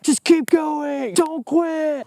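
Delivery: high, slow-stretched motivational holler; mock pep talk. Host parodies a motivational speaker, high mock holler. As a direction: cheer them on in a hammed-up high voice.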